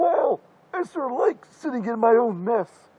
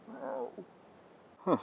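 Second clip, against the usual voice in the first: normal talking voice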